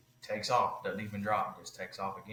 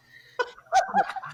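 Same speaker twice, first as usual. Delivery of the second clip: big laugh breaking out; loud laugh; 12 dB louder than usual. Real, not acted. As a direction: burst of high laughter. Laughs at own story.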